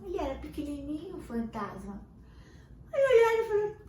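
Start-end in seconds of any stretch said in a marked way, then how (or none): none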